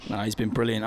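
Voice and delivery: ordinary talk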